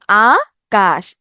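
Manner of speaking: "আকাশ" very slowly, long rising আ, pause, falling কাশ; teacher's slow two-beat split of "Akash"; broken into syllables. Shown as a demonstration. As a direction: pull "Akash" apart into আ and কাশ. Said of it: "ভেঙে ভেঙে উচ্চারণ করলাম".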